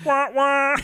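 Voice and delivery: high-pitched wah-wah sting; high, mocking "womp womp" noise; mock sad trombone. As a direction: imitate a comic failure trombone. Mock trombone after a failed joke.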